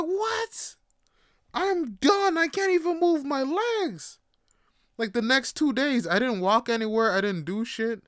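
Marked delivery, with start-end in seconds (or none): 0.0-4.2 very high, squeaky disbelief; retold high-pitched whine of total exhaustion; incredulous protest. Exhausted disbelief at tireless uncle.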